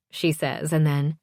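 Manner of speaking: plain reading voice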